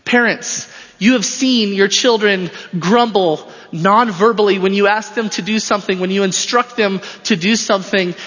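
ordinary delivery

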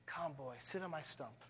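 put-on tree gently inviting the old man to rest; tender old tree. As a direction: offer the last thing it has, softly. Old tree's last offer.

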